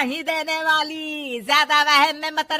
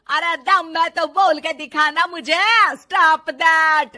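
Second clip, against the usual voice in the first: angry taunt; 4 semitones higher, 6 dB louder than usual. Acted. Mother-in-law taunting challenge.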